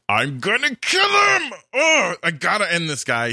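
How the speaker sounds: high, slow shouted rage; furious Saul. Retold or imitated. Saul fed up with David, shouting in high rage. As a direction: yell it out like a raging king.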